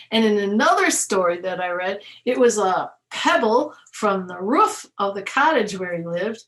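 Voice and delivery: usual speaking voice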